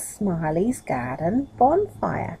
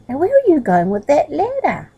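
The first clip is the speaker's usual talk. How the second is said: surprised; 7 semitones higher, 5 dB louder than usual. Acted. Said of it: "in surprise".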